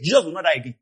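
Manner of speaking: normal talking voice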